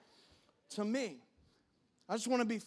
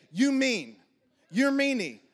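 loud mock toddler pout; toddler accusing; 5 semitones higher, 10 dB louder, slower than usual. Toddler son calling his dad mean, loud pout. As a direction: whine it like a cross two-year-old.

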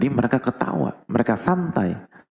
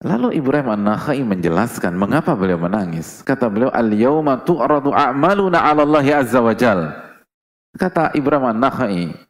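loud, higher proclamation of the Arabic; preaching at full force; 5 semitones higher, 7 dB louder than usual. Acted. Preacher proclaiming the scholar's Arabic words loudly. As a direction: declaim it to the whole hall.

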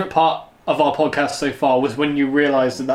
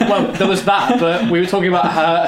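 emphatic; 4 semitones higher, 4 dB louder than usual. Real, not acted. Emphatic correction, loud.

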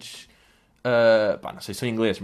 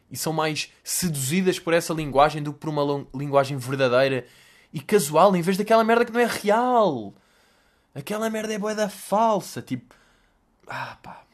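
ordinary talk

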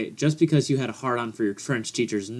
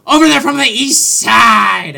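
much higher, louder, slow hype-man call; arena announcer; 10 semitones higher, 7 dB louder, slower than usual. Pretend. Co-host hyping the guest's entrance in a mock announcer voice. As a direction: stretch it out like a boxing ring announcer.

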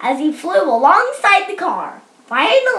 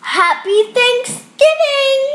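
high, slow, drawn-out holiday greeting; festive cheer; 8 semitones higher, 3 dB louder, slower than usual. Real, not acted. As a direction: cheer it out, stretching the words. Host closes the song with greeting.

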